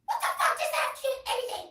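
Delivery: high, loud imitated mom shriek; mock mom screeching back; mom yelling. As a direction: shout it like the outraged mom. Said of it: "I said".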